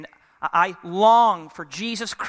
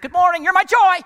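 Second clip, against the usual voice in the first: much higher and louder, gushing over the top; overdone greeting; 13 semitones higher, 9 dB louder than usual. Shown as a demonstration. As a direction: greet them like you're bursting, too much. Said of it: "Whoa, easy".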